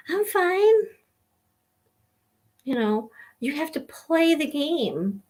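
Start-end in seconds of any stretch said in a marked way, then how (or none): 0.1-0.7 forced I'm fine. Retold or imitated. Hiding tears from partner.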